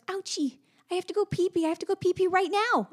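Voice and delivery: put-on high, urgent toddler whine, lightly done; little girl voice. Mother imitating her daughter's urgent little voice. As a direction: imitate a toddler who must go now.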